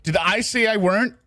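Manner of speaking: alarmed. Real, not acted. Catching his own grammar slip.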